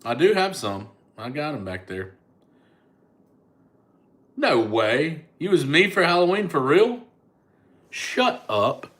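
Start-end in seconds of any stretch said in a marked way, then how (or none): 4.3-8.9 real high, loud astonishment; amazed, pitch leaping up on the questions; delighted disbelief. Real, not acted. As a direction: exclaim it like you can't believe your luck. Learns a fan dressed as them.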